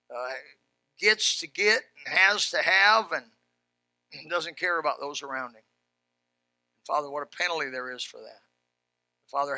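low, subdued praying voice; prayer. Real, not acted. Pastor praying for a generous church, low hushed prayer. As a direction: lead a quiet, earnest prayer to the Father.

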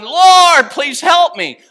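loud, high cry to God; drawn-out, full-voiced cry for help. Shown as a demonstration. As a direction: holler a desperate plea to the Lord. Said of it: "normally if I'm crying, I'm going".

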